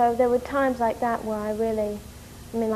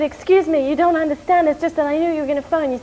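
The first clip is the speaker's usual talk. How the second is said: louder, higher and fast, tumbling out in embarrassment; flustered rush; 5 semitones higher, 8 dB louder than usual. Retold or imitated. Explaining to the caller in a flustered, hurried rush. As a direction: gabble an apology, still wound up. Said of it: "I got very hysterical".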